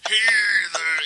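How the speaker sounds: high, loud yelled heathen; mock shout. Friend pretending to scrawl on the screen, hammed-up yell. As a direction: shout it like a crazed preacher.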